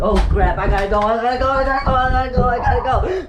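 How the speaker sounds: loud, rising panic, fleeing; frantic repeated escape cry; panicked. Real, not acted.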